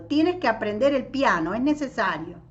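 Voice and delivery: high, loud, insistent near shout; almost shout it at the boy; urgent near-shouted order. A narrator's reading. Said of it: "le dijo casi gritando".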